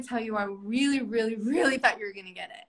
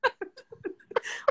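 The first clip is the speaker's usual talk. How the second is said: laughing; 9 semitones higher, 4 dB quieter than usual. Real, not acted. Breaks up mid-script.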